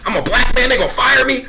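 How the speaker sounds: imagine the worst and fret; panicked inner voice. Retold or imitated. The new hire wondering if he's fired, panicked and high. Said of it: "I'm like".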